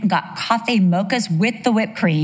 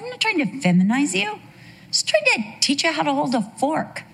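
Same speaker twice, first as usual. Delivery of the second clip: higher-pitched mock protest; mildly exasperated mom, pitched up; 8 semitones higher than usual. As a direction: defend yourself to your child. Exasperated mom answers screaming son.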